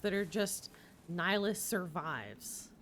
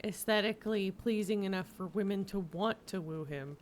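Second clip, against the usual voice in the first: ordinary talk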